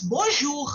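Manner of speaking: Bonjour with the R from the throat; French throat R. Shown as a demonstration. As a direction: end Bonjour on a guttural French R. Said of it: "it goes like this".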